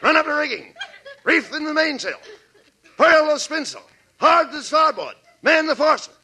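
barked orders. Acted. Barking nautical orders.

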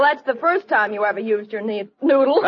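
normal talking voice

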